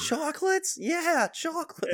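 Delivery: very high, slow, drawn-out cartoon voice; exaggerated falsetto impression; cartoon character impression. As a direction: squeak it out like a doddering cartoon character. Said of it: "does a great impression of that".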